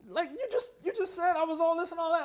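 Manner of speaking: very high, loud mock protest; hammed-up squeaky protest, quick and loud; baffled character. Acting Travolta's baffled protest in recap.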